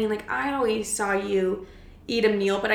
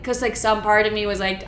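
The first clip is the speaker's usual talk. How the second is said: usual speaking voice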